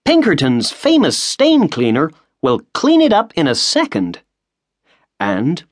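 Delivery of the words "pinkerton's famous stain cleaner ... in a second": eager salesman pitch. A narrator's reading. Washington boasting about the stain cleaner, high and eager. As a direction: sell the cleaner like an ad. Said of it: "cried Washington Otis".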